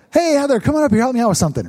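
bright, high friendly call-up; mock pastor inviting a volunteer; cheery sermon call-up. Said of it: "That, that tone, that voice".

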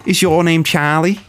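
lightly done Scouse demand, swearing; Scouse accent. Acted. Asking a friend for the number plate, mock Scouse accent. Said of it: "I'm in character for Scouse".

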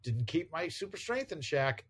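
a lightly voiced sheepish oops to oneself; talking to himself. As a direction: play a superhero's little oops moment. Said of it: "says to himself".

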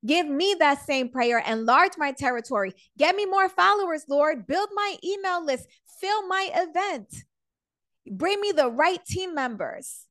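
"Lord, give me" pleading, pitched high; demanding prayer. Pretend. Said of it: "So then you say".

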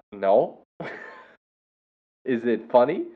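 ordinary delivery

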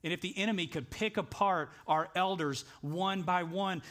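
ordinary talk

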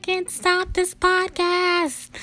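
high, slow hammed-up whine; mock panicked wail. Retelling her thoughts when the phone rang, high mock whine. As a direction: squeal it like a playful cry of despair.

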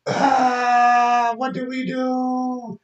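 high, slow whiny wail; mock despair. Vlogger mimicking guilty parents, high slow wail. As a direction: wail it like you're lost.

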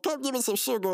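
high put-on invitation; hug-happy brother. Brother welcoming a new acquaintance, high mock friendliness. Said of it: "And he said".

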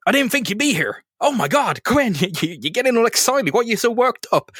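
high, fussing father voice imitated; mock fussing dad. Dad fussing over his daughter, mock high voice. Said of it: "He's like".

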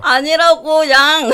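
cutesy yang tic. Acted. Saber cosplayer adds verbal tic.

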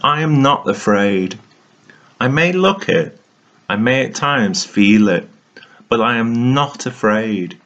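ordinary talk, nothing marked

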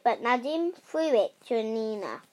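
plain reading voice

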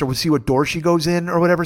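ordinary talk, nothing marked

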